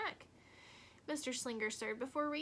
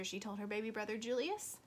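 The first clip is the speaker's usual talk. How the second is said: ordinary delivery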